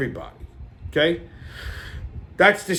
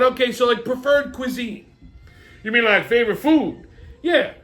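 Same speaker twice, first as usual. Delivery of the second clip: brash comic family banter; hammed-up sketch characters; 11 semitones higher than usual. Characters bicker over the host's questions in high put-on voices.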